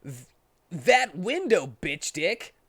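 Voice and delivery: sudden rage. Acted. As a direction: spit out the insult in rage. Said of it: "a sudden rage build up inside".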